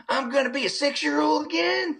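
very high whiny doll voice; Chucky voice. Retold or imitated. Doll dreads becoming a child again, very high whine. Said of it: "he's like".

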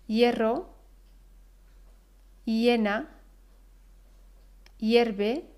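"hierro", "hiena", "hierve" con ye inicial; hie como ye. Shown as a demonstration. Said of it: "escuchamos ese sonido ye". ("hie" pronunciado "ye")

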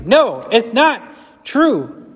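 rising, emphatic preacher voice; preaching at full force. Acted. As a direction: build it up, proclaim it loud. Preaching climax.

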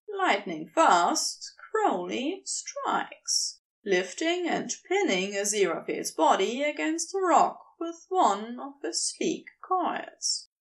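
ordinary delivery

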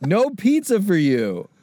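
mock parent. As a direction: shoo the kids off like an annoyed parent. Playing the sleepover parent.

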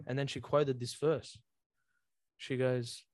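ordinary delivery

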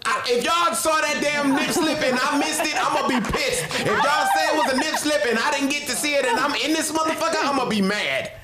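high excited complaining; mock outrage. Real, not acted. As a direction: whine it, playfully indignant. Mock outrage about nip slip.

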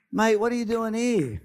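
broad Australian "Mate, what are you doing here?"; imitating a matey Australian; casual Aussie slang voice. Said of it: "To use the Australian ver-, vernacular".

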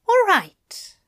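high, resolute angel; determined angel voice. A narrator's reading. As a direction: steel yourself and say it. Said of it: "he said with determination".

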